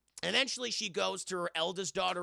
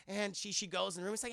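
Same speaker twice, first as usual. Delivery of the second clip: ordinary delivery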